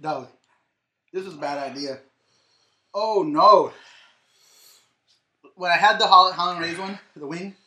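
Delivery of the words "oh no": pained gasp from the heat; burned tongue. Real, not acted. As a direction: say it with a burning mouth. Mouth burning from the chip.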